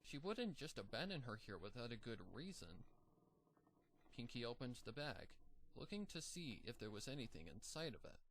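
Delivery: usual speaking voice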